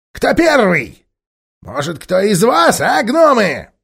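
high, loud taunting ape; high loud goading ape; sly ape goading. Acted. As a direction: dare them to go first.

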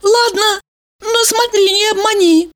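high teasing woman's voice; Valeria's voice. A narrator's reading. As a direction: warn him, high and playful.

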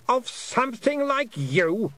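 high, slow queenly disdain; scornful Queen. A narrator's reading. Queen dismissing the girl as no threat, high scornful voice. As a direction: draw it out with regal contempt. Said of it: "said the voice of the Queen".

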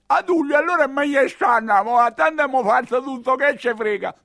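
shouting high at a henchman; loud, high, heated argument; loud high retort. Acted. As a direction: press him loudly to give up. Arguing with the boia.

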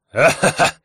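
booming barbarian laugh; hearty laugh. Acted.

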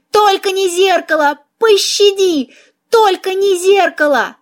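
high-pitched mouse wail of despair; desperate pleading. A narrator's reading. Mouse begs the heroine to spare the mirror, desperate pleading. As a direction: beg for mercy, pitched well up. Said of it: "завопила мышь, в отчаянии".

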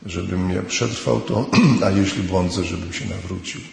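ordinary talk, nothing marked

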